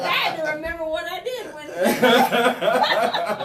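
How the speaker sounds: loud, slow laughing confession. Real, not acted.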